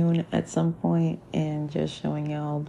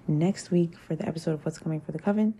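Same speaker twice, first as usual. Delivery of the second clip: normal talking voice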